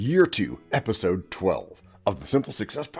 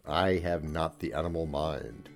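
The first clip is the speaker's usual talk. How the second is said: normal talking voice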